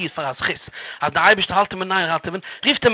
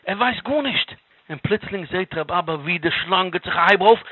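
ordinary delivery, nothing marked

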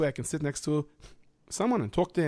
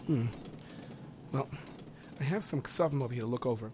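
ordinary talk, nothing marked

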